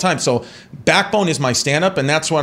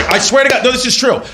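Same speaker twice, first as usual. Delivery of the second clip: excited insistence; 11 semitones higher, 5 dB louder than usual. Real, not acted. Insisting the story is real.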